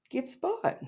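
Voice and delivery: very high, tiny and soft; squeaky mock cow. Teasing her cow clip, second take, squeaky high. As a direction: squeak it out with a rising end. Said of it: "you showed, like".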